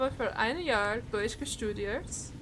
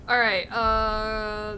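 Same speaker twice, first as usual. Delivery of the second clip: drawn-out all right; 5 dB louder, much slower than usual. Real, not acted. Drawn-out transition.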